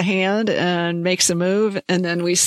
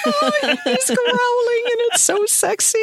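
much higher, laughing swoon; swooning fan; 13 semitones higher than usual. Retold or imitated. Fan swooning at Matthew's growl, high laughing swoon. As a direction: swoon and giggle over the growl.

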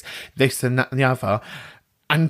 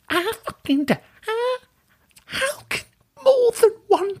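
squeaky high, hammed-up indignation; mock outrage. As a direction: squeal in pretend disbelief. Outraged at anti-potato caller.

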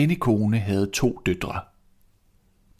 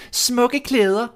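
put-on high, sneering spoiled girl; mean stepsister voice; 5 dB louder than usual. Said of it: "sagde den ene". (høj, hånlig stemme som en ond stedsøster)

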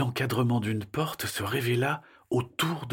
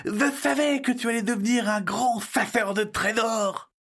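excited exclamation; 10 semitones higher than usual. Acted. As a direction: exclaim in high-pitched wonder at a great find. Child exclaims at finding an entrance. (exclamation enthousiaste)

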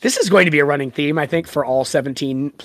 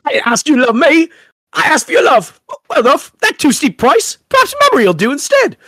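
higher, hammed-up Cockney bargaining; a scheming fairy in a terrible Cockney, rushing the last line; bad Cockney fairy; 10 semitones higher, 4 dB louder than usual. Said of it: "worst version of a Cockney".

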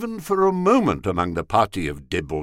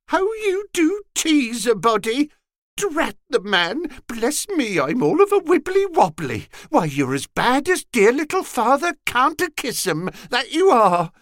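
high mock-female simpering; a young blood camping it up as a coy woman; womanish simper; 4 dB louder than usual. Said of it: "feigning a womanish simper".

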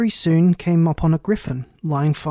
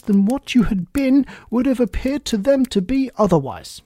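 higher, louder rambling duchess voice; fussy duchess; 5 semitones higher, 5 dB louder than usual. Acted. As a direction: pile up the clauses pompously.

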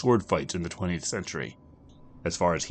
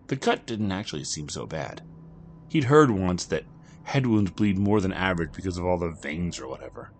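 plain reading voice

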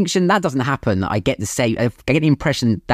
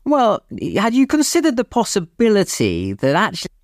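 high, sing-song polite Brit, much higher; mock-courteous civil servant, sweetly high; exaggerated genteel British politeness; overly polite British official; 11 semitones higher than usual. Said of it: "that is a British thing of saying".

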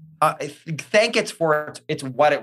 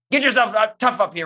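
tough pep talk; 7 semitones higher than usual. Retold or imitated. Coaching herself through dark moments, a tough pep talk. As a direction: bark a gruff wake-up at yourself. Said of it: "use that voice with yourself".

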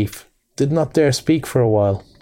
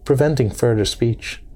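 ordinary delivery

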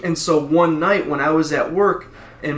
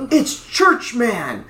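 announcing; 8 semitones higher, much slower than usual. Retold or imitated. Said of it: "And they said".

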